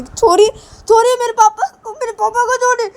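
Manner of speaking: put-on very high, loud tearful little boy; a small child crying and begging. Little son pleads through tears to free his father. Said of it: "रोते-रोते".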